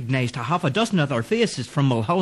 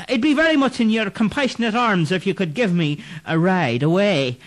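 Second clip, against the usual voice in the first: higher, wheedling plea; coaxing Irishman; 8 semitones higher than usual. Acted. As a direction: sweet-talk her into a ride. Clancy coaxes the ghostly rider.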